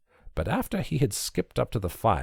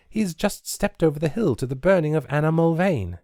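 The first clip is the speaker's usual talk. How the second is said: put-on higher girl's voice, plain telling; young lass voice; 9 semitones higher, 3 dB louder than usual. A narrator's reading. Nancy telling him where her father went, higher girl's voice.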